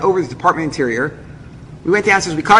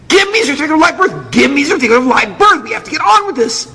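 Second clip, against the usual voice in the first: high-pitched frantic demands; increasingly nervous; 8 semitones higher than usual. Retold or imitated. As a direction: badger them in a mock panic. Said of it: "getting more and more nervous".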